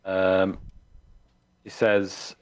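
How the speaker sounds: ordinary talk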